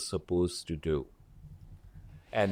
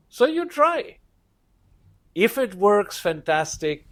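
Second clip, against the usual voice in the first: excited cheer; 8 semitones higher than usual. Real, not acted. Upbeat cheering of success.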